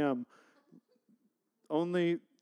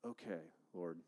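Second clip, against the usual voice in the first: hushed aside; 11 semitones lower, 15 dB quieter than usual. Retold or imitated. Awed aside on hearing Antioch link.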